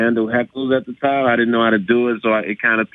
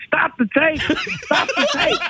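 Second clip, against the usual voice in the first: squealed mock scream, pitched way up; retold scream; slower than usual. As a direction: reenact screaming into the camera. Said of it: "looking into my own camera screaming".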